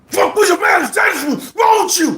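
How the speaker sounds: very high shouted outburst; mock shout. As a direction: explode like a boxer losing it. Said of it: "let me give you an example".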